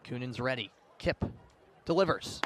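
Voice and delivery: usual speaking voice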